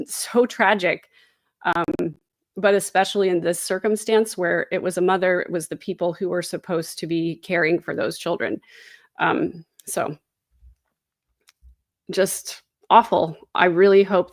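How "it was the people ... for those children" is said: usual speaking voice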